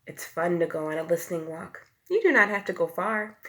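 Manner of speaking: usual speaking voice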